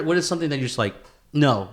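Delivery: usual speaking voice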